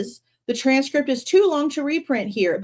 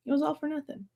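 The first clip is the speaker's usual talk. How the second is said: "It was all for nothing" echoed much softer, a little higher; quiet repeat; 4 semitones higher, 10 dB quieter, faster than usual. Real, not acted. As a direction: murmur "It was all for nothing" back to yourself. Softly repeats own line.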